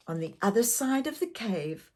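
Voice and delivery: plain reading voice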